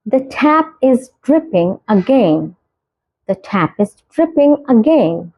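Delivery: very slow, each word spaced out; slow clear example. Shown as a demonstration. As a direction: model the sentence slowly for learners. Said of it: "example sentence read slowly". (धीरे, साफ़ उदाहरण वाक्य)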